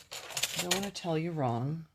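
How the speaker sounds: ordinary delivery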